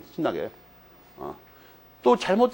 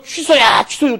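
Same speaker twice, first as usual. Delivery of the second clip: louder, higher, gleeful cry; excited shout; 6 semitones higher, 7 dB louder, much slower than usual. Retold or imitated. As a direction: call it out while charging in. Shouting while rushing in.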